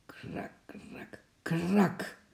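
from barely audible crackle to sharp crack; imitated cracking ice. A narrator's reading. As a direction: whisper it like ice giving way.

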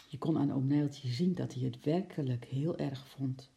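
ordinary delivery, nothing marked

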